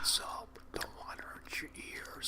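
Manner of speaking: hushed whisper. Real, not acted. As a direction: whisper so it won't hurt their ears. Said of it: "you don't have to cover my ear when you're whispering".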